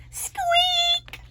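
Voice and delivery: high whispered squeak; tiny squeak. Acted. Mouse squeaking at Owl's bedtime, tiny high squeak. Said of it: "no louder than a whisper".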